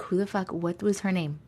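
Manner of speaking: low, hushed aside; acted private puzzlement. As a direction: wonder under your breath. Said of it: "I'll be like".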